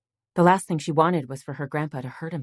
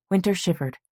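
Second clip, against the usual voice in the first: plain reading voice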